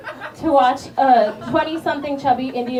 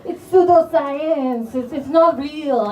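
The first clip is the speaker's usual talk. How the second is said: slow, sneering know-it-all; mocking astrology skeptic; 2 semitones higher, 4 dB louder, slower than usual. Comic mocks people who call astrology pseudoscience, slow and sneering. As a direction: drag out the scoff like a smug skeptic. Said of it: "they're like".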